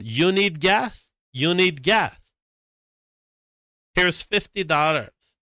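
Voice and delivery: mock magnanimous offer. Acts out confronting the man with cash.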